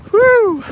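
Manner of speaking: a high, loud whoop of delight in the snow; loud excited whew. Real, not acted. Vlogger whooping in a snowstorm, loud excited whew. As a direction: let out a big thrilled whew. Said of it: "Maybe I'm yelling out here too much".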